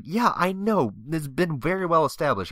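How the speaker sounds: high, fed-up yeah I know; exasperated yeah. Retold or imitated. Told again he's in the wrong club, a high exasperated reply. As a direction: roll your eyes in the voice.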